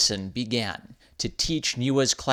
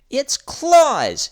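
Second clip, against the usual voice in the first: grand; 7 dB louder than usual. Acted. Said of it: "He made it sound grand".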